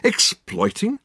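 high, rising affronted echo; indignant surprise. A narrator's reading. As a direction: bristle at the word, mock-offended. Dirk affronted by the accusation.

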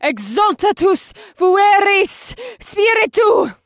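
very high, slow, loud spell-casting scream; screamed incantation. A narrator's reading. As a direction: scream the spell, drawing out each word. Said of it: "screaming an incantation".

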